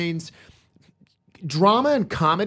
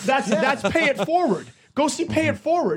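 high mocking voice; 6 semitones higher than usual. Mock sermon on comedy.

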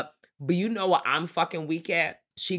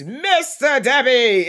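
drawn-out announcement; 8 semitones higher, 7 dB louder, slower than usual. Retold or imitated. Quoting the title.

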